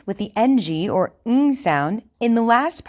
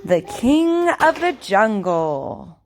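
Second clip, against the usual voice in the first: over enunciating. Shown as a demonstration. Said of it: "I want you to repeat after me".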